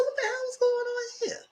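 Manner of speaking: high squeaky disbelief; mock outrage. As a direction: ask it in a high shocked voice. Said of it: "I said".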